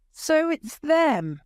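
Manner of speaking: high, slow shout. A narrator's reading. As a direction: shout it out in anger. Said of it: "he shouts".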